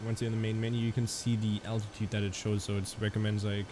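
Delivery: ordinary talk, nothing marked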